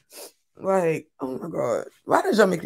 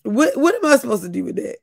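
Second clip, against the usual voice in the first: ordinary talk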